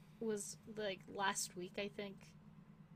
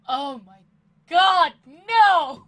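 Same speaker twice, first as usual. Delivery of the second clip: exasperated cry; 6 semitones higher, 20 dB louder, slower than usual. Retold or imitated. Retold inner panic at phone.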